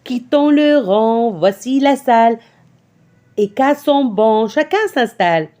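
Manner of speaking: measured, rhythmic poem reading, phrase by phrase; poem recitation. Acted. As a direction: recite it to a class with clear, even beats.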